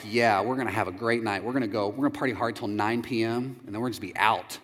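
low, soft sarcastic hype; deadpan fake enthusiasm; mock party excitement. Couple joking about an early night, dry. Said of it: "We're like".

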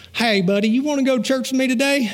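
lightly voiced eager country buddy; a hint of a chirpy country bumpkin; Gomer Pyle. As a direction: pitch it up into a friendly invitation. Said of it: "He was Gomer Pyle".

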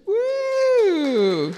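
high, loud celebratory whoop; joyful whoop. Real, not acted. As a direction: let out a real joyful cheer. Celebrating daughter's good news.

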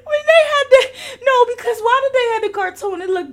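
high, loud, laughing through words; cracking up. Real, not acted. Podcaster remembering Proud Family episode, shrieking with laughter. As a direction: squeal it out through helpless laughter. Cracking up over cartoon memory.

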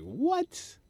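high rising startled what; mock surprised what. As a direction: act stunned. Surprised show was packed.

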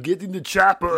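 rough attempt at a thick Austrian accent; Arnold Schwarzenegger. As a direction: bellow it in a hammy Austrian accent. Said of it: "I can't do Arnie at all".

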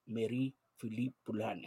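soft French-accented Marie-Philippe Poulin; quiet French pronunciation demo. Shown as a demonstration. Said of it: "Have that French accent".

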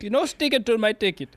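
put-on Indian accent. Driver objecting to the ticket writer, Indian accent. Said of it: "I'm gonna imitate the person".

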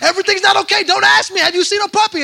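high, loud panicked outburst, acted; frantic panic. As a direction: play frantic, yelling at a neighbour. Retold frantic search, snapping at neighbor.

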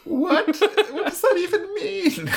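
high laughing disbelief; a laughing what-on-earth; baffled. Real, not acted. Co-host reacting to the odd line, laughing disbelief.

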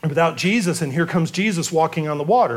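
ordinary talk

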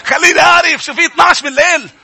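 high, loud imitation of a pleading woman; retelling a tearful sister. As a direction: plead urgently as the upset sister. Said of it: "قلت لي". (تقليد أخت باكية بصوت عالٍ)

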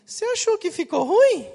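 voz bem aguda imitando a moça; descontente. Retold or imitated. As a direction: pergunta cobrando, fina e mais alta. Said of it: "não contente com a minha resposta assustada". (imitação aguda de moça descontente)

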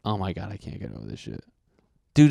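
ordinary delivery

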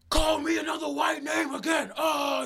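high, strained mock shout; hollering. Imitating the man pulled away by his friend, hollering. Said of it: "he kept hollering".

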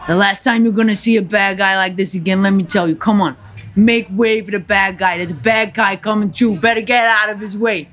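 put-on thickly accented gangster monologue; defiant, punchy Cuban tough guy; Tony Montana. Gangster addresses a crowd of onlookers, defiant character voice. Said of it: "to Tony Montana".

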